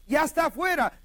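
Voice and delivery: high, loud excited insistence; acted girl insisting loudly; insistent cry. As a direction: cry the news out to disbelievers. Acts Rhodes insisting.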